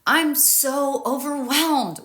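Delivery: loud, high, drawn-out mock wail; acted-out overwhelmed outburst. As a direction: stretch it out like it's all too much. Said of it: "how I talk to myself when I'm overwhelmed".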